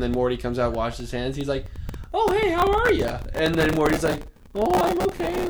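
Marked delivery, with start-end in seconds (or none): 2.1-3.2 high-pitched creepy greeting; creepy bean creature. Retold or imitated. Acting creepy character in retold scene. 4.5-5.5 high-pitched put-on kid voice; Morty. Voicing Morty in retold scene.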